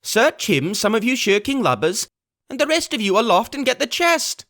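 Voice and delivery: high, raging command; angry cry. Acted. Blind beggar cries orders at his crew, angry. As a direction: whip the lazy crew into action. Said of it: "he cried".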